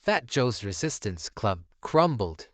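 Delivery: usual speaking voice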